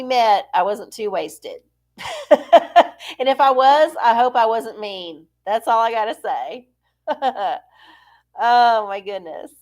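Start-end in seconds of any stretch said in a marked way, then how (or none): 2.0-3.1 loud, high burst of laughter. Real, not acted. Host joking about having been too wasted, laughing loudly. 7.1-7.6 short chuckle. Real, not acted. Host done confessing to viewers, short self-amused laugh.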